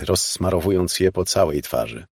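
ordinary delivery, nothing marked

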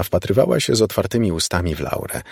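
ordinary delivery, nothing marked

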